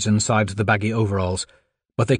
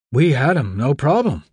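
louder, offhand excuse; defensive shrug; 4 semitones higher, 3 dB louder than usual. Acted. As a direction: brush off the blame.

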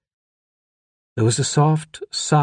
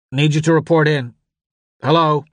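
raised, louder voice calling in; urgent radio caller; 6 semitones higher, 5 dB louder than usual. A narrator's reading. A voice over the crackling radio calls in, urgent. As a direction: call out for someone to report in.

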